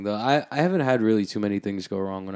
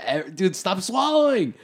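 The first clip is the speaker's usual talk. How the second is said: much higher, half-joking squeal; pleading squeal; 10 semitones higher, slower than usual. Real, not acted. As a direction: squeal it like you're losing it. Begging friend to stop swallowing.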